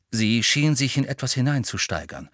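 usual speaking voice

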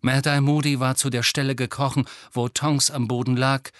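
ordinary delivery

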